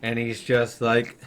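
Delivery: ordinary delivery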